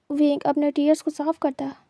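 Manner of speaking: ordinary delivery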